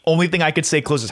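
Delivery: ordinary delivery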